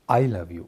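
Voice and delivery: "I love you" with the weight on I; stress on I. Shown as a demonstration. Actor demos stressing I in a radio workshop. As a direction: lean on I, not on love. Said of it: "अगर मैं आई पर emphasise करता हूँ".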